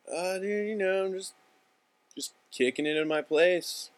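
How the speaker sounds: a casual "just hanging out" reply; laid-back slacker. Acted. Casual phone reply acted out.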